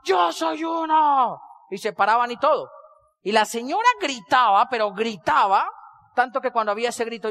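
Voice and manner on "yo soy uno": slow drawn-out crowd chant, a little higher; stretched-out rally cry; mock crowd shout. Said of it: "la gente gritaba".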